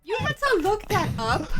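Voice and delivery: high, loud mock disbelief; mock shock. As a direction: act scandalized, teasing.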